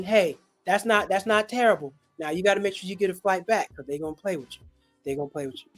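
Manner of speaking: normal talking voice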